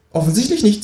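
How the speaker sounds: high, dry kangaroo answer. Acted. Kangaroo mocking the author, high and dry. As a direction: drop it in the high voice, deadpan. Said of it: "sagt das Känguru".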